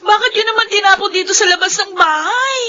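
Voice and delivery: distressed near-crying complaint; tearful protest. Acted. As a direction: beg her grandmother to stop shaming her. Clothes thrown out, humiliated.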